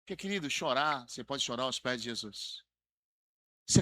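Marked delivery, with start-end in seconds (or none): none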